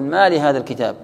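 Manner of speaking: "ما لهذا الكتاب" run together loudly, stopping on the b; stop only at end. Shown as a demonstration. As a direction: carry straight through to the end of "الكتاب". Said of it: "تقف على الأخير".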